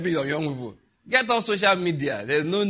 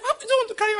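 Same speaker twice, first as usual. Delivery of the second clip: pleading wife; 3 dB louder, much faster than usual. Retold or imitated. Wife questioning him.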